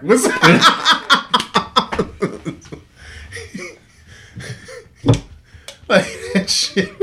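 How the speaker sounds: high laughing greeting; cracking up quoting the buddy's greeting, high. Real, not acted. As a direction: laugh through the quote.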